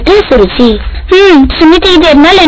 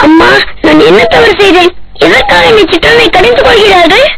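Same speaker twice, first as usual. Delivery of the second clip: put-on a hurt young boy pleading, higher voice; tearful child; 7 semitones higher than usual. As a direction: ask your mother like a wounded little boy. Child complains to mother, upset.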